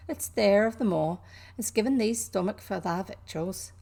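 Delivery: lightly voiced Yorkshire maid; a hint of broad Yorkshire; mild Yorkshire lilt; Yorkshire accent. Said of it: "apologies for the accent".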